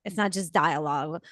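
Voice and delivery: normal talking voice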